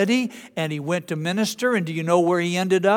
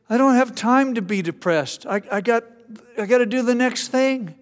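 much higher and louder animated preacher voice; John Piper voice; 7 semitones higher, 5 dB louder than usual. Retold or imitated. Piper remembering his friendship with MacArthur, high and eager. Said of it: "he's got a high-pitched voice".

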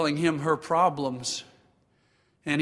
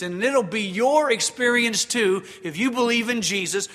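loud, raised, emphatic sermon delivery; full-force preaching; 5 semitones higher, 6 dB louder than usual. Acted. Pastor exhorting the congregation on struggling against sin, full-force preaching. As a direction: preach it out with force, higher pitched.

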